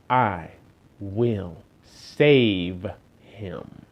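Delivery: low, soft and very slow; solemn God voice. Acted. God promising to save Charles, low and slow. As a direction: speak as a calm, mighty God.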